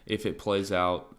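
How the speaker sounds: ordinary delivery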